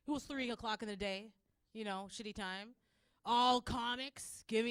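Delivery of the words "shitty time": ordinary talk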